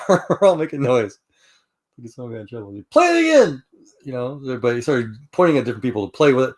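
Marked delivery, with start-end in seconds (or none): 2.9-3.6 high, loud demanding shout; band director yelling. Retold or imitated. Band director to noisy class, high loud shout. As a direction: bark it out like a startled band teacher.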